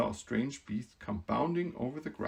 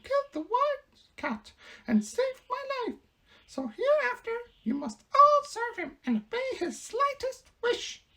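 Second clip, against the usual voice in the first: mouse queen voice; 5 dB louder than usual. Acted. Queen telling the Woodman who she is, proud squeak. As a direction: announce her rank grandly in a squeak.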